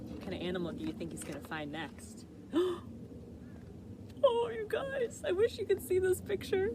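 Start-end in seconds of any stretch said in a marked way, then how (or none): 2.3-4.2 delighted gasp. Real, not acted. Reader reacts to picture. 4.2-6.7 excited delight. Real, not acted. Reader to children, gushing about the picture, high and thrilled.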